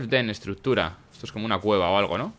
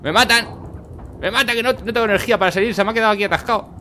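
panicking gamer; 11 semitones higher than usual. Real, not acted. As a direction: panic as you get swarmed. Gamer swarmed, character stuck, dying.

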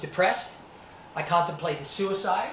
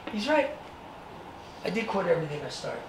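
usual speaking voice

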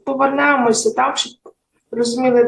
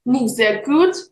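ordinary talk, nothing marked

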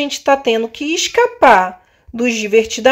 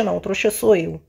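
ordinary talk

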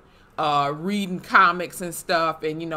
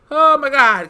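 loud, high burst of Oh my God; loud exclamation; 9 semitones higher, 12 dB louder than usual. Real, not acted. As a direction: blurt it out as the memory hits. Painful school memory exclamation.

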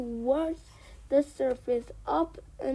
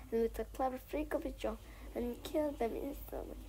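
ordinary delivery

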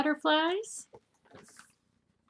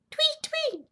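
very high little chirps; bird tweets. Acted. Reader voices birds for children, very high chirps.

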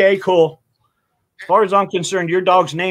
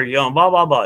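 ordinary delivery

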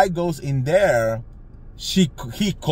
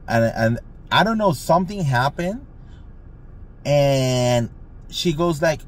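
ordinary talk, nothing marked

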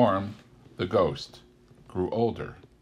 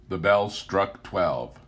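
plain reading voice

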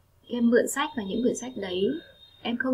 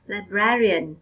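librarian with the second syllable, brar, stressed and clearly stretched; 4 dB louder, slower than usual. Shown as a demonstration. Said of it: "các em nhấn mạnh vào âm tiết số hai".

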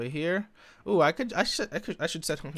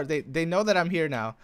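ordinary talk, nothing marked

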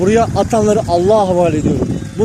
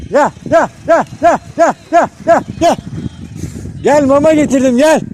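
high calling out; 6 semitones higher than usual. Real, not acted. Calling dogs over because he brought food, high call. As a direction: call them in, food is here.

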